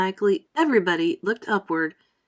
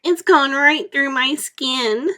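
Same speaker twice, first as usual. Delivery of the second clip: slow, higher, louder groan; 5 semitones higher, 5 dB louder, slower than usual. Acted. Earthworm complaining after the soaking, slow groan. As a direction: moan it like a self-pitying worm. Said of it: "the earthworm groaned".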